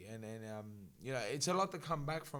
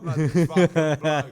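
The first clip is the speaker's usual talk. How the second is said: amused exasperation; 3 semitones higher, 15 dB louder, slower than usual. Retold or imitated. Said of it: "I'm like".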